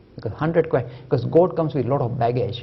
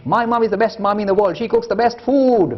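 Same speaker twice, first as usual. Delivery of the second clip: mock boyish boast; 6 semitones higher, 4 dB louder than usual. Boys quarrel over mothers.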